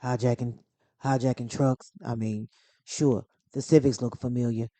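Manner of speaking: deepened tough-guy movie voice; low register, deliberate, spaced-out delivery; Dominic Toretto. Acted. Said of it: "I can get deeper".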